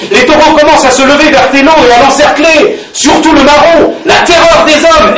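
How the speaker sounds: high, loud, urgent telling; Eric explaining. Acted. Eric warning about the bulls, urgent. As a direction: hurry to explain the danger. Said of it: "expliquait Eric".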